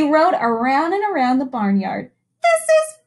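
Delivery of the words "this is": high, louder gleeful cry; a playful high-pitched cheer; high excited animals. Acted. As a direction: cheer it like happy farm animals. Animals riding bikes.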